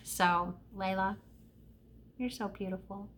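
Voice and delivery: very soft, gentle and slowed; tender. Real, not acted. Telling Layla she is beautiful, softly tender. Mother tenderly complimenting child beside her.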